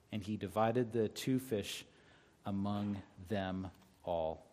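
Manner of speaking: low, soft reading from the Bible; scripture reading. A narrator's reading. Pastor reading the loaves and fishes verse to church, low and soft. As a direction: read it softly and low, phrase by phrase.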